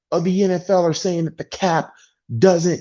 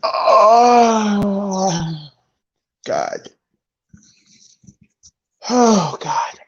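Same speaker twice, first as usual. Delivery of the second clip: exasperated groan; 2 semitones higher, much slower than usual. Real, not acted. Exasperated after the awful game.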